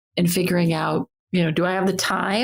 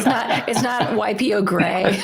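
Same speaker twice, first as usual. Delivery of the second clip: laughing; 3 semitones higher than usual. Real, not acted. Joking about YPO Gold name.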